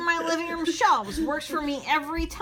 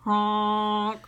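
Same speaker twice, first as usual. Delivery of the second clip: plain reading voice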